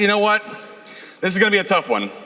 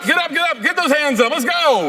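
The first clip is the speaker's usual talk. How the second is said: hype call; 7 semitones higher than usual. Real, not acted. Getting crowd up for selfie.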